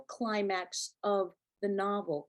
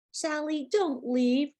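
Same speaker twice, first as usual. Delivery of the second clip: crying out; 7 semitones higher, 3 dB louder, slower than usual. A narrator's reading. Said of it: "our mother cried".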